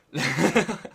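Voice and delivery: loud laughing burst. Real, not acted. Laughing at own joke.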